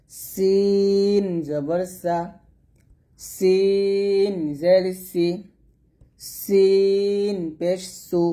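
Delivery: slow, drawn-out syllables: সিন জবর সা with a light thin স; thin sin sounds. Shown as a demonstration. Teacher drilling students in a slow, thin Arabic sin. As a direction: spell out each syllable slowly and lightly. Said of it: "পাতলা করে".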